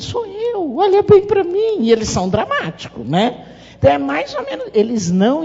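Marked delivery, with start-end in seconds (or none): 0.0-1.8 high-pitched pleading quote; mock dramatic child begging to be recognised; dramatic plea. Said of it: "faz um escândalo".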